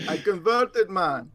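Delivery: high, playful Jamaican Rasta voice; Rastafarian accent. Pretend. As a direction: put on a laid-back Rasta accent. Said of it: "Are you doing the Rastafarian accent today?".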